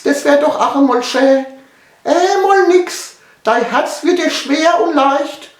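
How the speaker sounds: very high, eerie monotone chant; luring spirit voice. Acted. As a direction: draw out each line on one high pitch. Spirit voice tempts again. (hohe, lockende Geisterstimme)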